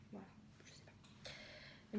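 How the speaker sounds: slow breathy whisper, trailing off. Real, not acted. Vlogger unsure of the shade, whispering to herself. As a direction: let it fade into a whisper.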